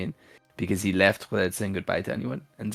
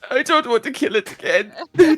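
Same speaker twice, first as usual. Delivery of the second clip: high, whiny pleading with laughter in it; hammed-up tearful protest; mock crying; 6 dB louder than usual. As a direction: plead in a high, put-on wail. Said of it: "he is visibly crying and muttering".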